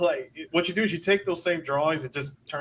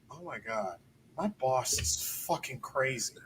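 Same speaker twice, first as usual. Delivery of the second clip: quiet; 4 semitones lower, 11 dB quieter than usual. Retold or imitated. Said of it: "I know what she's thinking".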